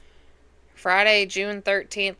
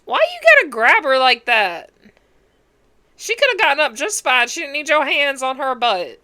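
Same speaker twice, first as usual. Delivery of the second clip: higher-pitched, indignant telling-off; indignant scolding; 6 semitones higher, 5 dB louder than usual. Real, not acted. Viewer scolding a man on screen who grabbed a woman, indignant. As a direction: scold him for getting handsy. Scolding a film character for groping.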